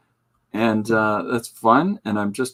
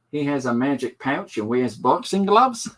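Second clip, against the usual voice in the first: put-on higher-pitched grade-school kid's voice; put-on child voice, pitched up; kid reading aloud; 5 semitones higher than usual. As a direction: read it out like a little boy's book report. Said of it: "trying to capture that voice of a kid".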